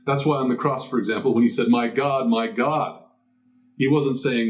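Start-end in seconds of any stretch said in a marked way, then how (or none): none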